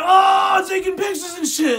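very high, loud, gushing mimicry; mock fawning excitement. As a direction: squeal it like starstruck new buddies. Mimicking the pair suddenly being friendly.

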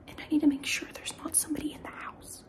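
whisper. Real, not acted. Whispering while home alone.

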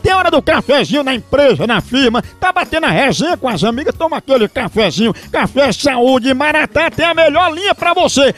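upbeat radio ad voice; ad read. Acted. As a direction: sell it like a radio spot.

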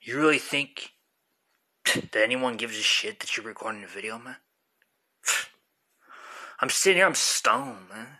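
bored stoned dude, dismissive; arguing with myself. Acted. One man plays both sides.

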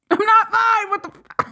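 high-pitched burst of disbelief; overwhelmed outburst. Real, not acted. Fan losing composure over the performance, overwhelmed outburst. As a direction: break out suddenly, pitch jumping up. Composure breaks after saying fine.

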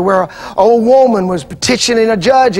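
normal talking voice

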